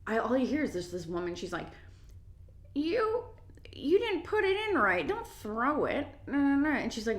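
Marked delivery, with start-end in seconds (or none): 2.8-6.7 imitating a fussy customer, much higher; high mocking scold with drawn-out words; whiny rude customer. Rude customer nagging a store employee, mock scolding.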